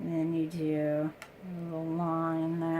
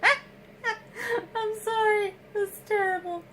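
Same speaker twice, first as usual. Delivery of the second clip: real giggling, much higher and slow; laughing apology; 3 dB louder, slower than usual. Real, not acted. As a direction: apologize while cracking up. Cracking up at the sponge.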